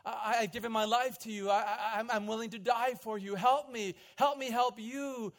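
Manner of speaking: loud, high desperate plea; a desperate prisoner begging God to free him; crying out. Retold or imitated. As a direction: cry out to God in anguish, pleading. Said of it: "he's crying out to Allah".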